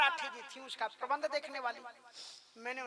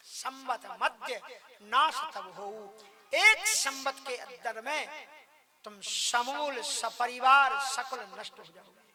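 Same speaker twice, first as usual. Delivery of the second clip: slow, emphatic pronouncement building louder; solemn angry curse; 6 dB louder than usual. Acted. Brahmins cursing the king's whole family to perish, angry pronouncement. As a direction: lay down the curse word by word. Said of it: "तुम्हें ऐसा श्राप देते हैं".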